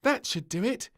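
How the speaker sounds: high, loud satisfied voice; a girl sure she's done; piping confidence; little princess. A narrator's reading. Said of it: "decided the little princess".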